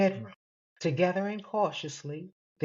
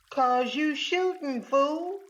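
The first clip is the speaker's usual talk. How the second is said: high, drawn-out comeback; exasperated retort; 10 semitones higher, slower than usual. A narrator's reading. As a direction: throw it back at the shooter, indignant. Startled couple quarrel after a gunshot.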